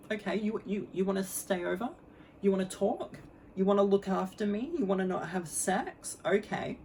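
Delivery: high, sing-song disbelief; put-on questioning. Replying to his offer to stay over, put-on questioning. Acting her skeptical reply to him.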